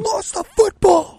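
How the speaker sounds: high, slow broadcaster wail; mock announcer call. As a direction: call the fumble like a dramatic announcer. Mocking a commentator call.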